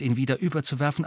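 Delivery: plain reading voice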